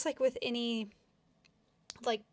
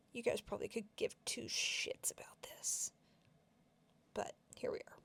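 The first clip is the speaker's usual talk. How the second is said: whispered aside; 6 dB quieter than usual. Real, not acted. Self-deprecating aside to viewers.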